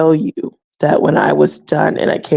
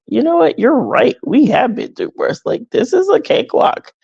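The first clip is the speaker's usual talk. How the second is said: higher-pitched, animated concession; lively, high-pitched "you're right" reply; retold emphatic agreement; 7 semitones higher than usual. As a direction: concede brightly, acting out the realization. Acts out agreeing with her kids.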